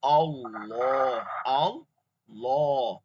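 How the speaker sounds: slow আল্লাহ with a thick, heavy l. Shown as a demonstration. Teacher showing the heavy l in আল্লাহ. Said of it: "মানে মোটা করে পড়া".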